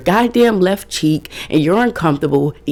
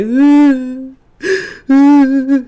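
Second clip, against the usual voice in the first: high, louder mock baby whimper; pouty fake cry; 10 semitones higher, 7 dB louder than usual. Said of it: "if I'm feeling pouty".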